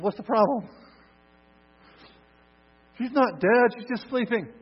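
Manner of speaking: high, loud, carefree questioning; breezy disbelief. Acted. Jesus to grieving family, loudly unconcerned. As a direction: call it out high, as if nothing is wrong.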